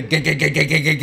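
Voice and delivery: rapid stuttering engine clatter; imitated car rattle. Imitating caller describing car trouble, mechanical rattle noise. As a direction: sputter like a faulty motor. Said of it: "my car is doing, like".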